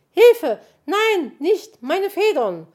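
very high, loud cries for help; screaming. A narrator's reading. Magpie faces scissors at her tail feathers, screaming. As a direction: shriek in alarm, full force. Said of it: "schrie sie".

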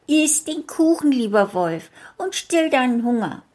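put-on high, bright child voice throughout; little girl talking. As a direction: chatter kindly as the little girl.

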